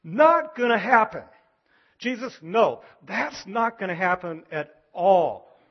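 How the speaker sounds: loud, high alarmed protest, slowing down; Peter protesting. Retold or imitated. Peter protesting Jesus' coming death, loud alarmed refusal. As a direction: cry out to stop him, no way. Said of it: "He goes".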